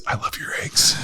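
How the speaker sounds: soft unvoiced whisper; hushed comic whisper. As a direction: whisper the punchline again. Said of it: "all I could think of is".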